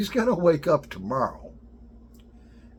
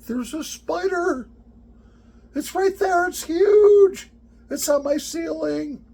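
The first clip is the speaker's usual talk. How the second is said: much higher and louder, a squealing panic; terrified girl; 7 dB louder than usual. Retold or imitated. Daughter shrieking to dad about the spider on her ceiling.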